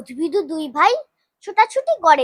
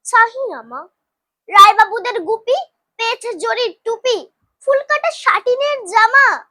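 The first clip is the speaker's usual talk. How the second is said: put-on higher-pitched childish protest; mildly acted crying child refusing; tearful complaint; 7 semitones higher, 3 dB louder than usual. A narrator's reading. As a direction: plead upward like an upset little boy. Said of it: "কাঁদিয়া কহিল".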